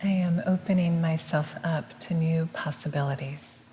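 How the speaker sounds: soft, measured meditative repeat; hushed affirmation, evenly spoken; affirmation echo. Real, not acted. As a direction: repeat it gently as a quiet response. Echoes the affirmation.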